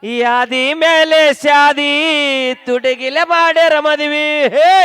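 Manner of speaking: loud, long drawn-out stage shout; slow, booming hype-man call; hyped song announcement. Real, not acted. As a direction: stretch the title out for the whole crowd. MC announces next song to crowd.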